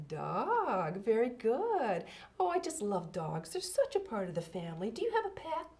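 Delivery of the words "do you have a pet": ordinary talk, nothing marked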